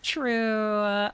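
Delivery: ordinary delivery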